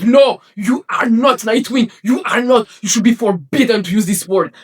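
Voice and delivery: high, loud furious shout; angry rant. Retold or imitated. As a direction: shout him down in outrage. Ranting at actor being Nightwing.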